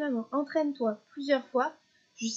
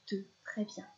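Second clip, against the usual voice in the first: ordinary delivery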